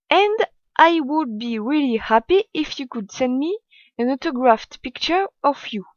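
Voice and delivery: slow, careful French-accented English; deliberate English in a strong French accent. Real, not acted. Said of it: "Désolée pour mon accent, je sais qu'il est très mauvais".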